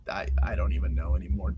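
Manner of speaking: low fast whisper; defeated whisper. Real, not acted. Host unsure how to say the name, whispering. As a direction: give up under your breath.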